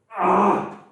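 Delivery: loud, high effort yell; a real yell of exertion; strained groan. Real, not acted. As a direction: push out the last rep with a cry. Straining on final reps.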